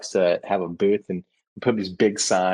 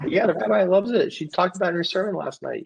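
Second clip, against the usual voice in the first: much higher, breezy mock reply through a laugh; sarcastic comeback; 9 semitones higher than usual. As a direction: toss it back sweetly, teasing the critic.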